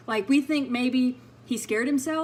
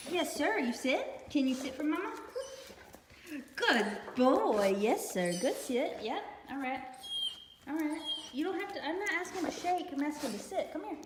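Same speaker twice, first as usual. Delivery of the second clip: soft, higher coaxing to a nervous dog; soothing the dog; 4 semitones higher, 6 dB quieter than usual. Real, not acted. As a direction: hush him gently, like calming a scared puppy.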